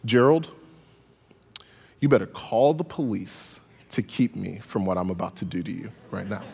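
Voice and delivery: low, soft, icy threat; menacingly calm. Retold or imitated. Mom quietly threatening her son, low and icy. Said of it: "she was so calm".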